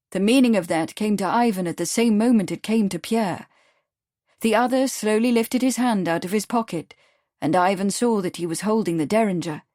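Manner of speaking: plain reading voice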